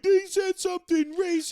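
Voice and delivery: high whiny imitation; high-pitched mocking voice; mock complaining player. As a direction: mimic a player tattling to the media. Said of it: "being like".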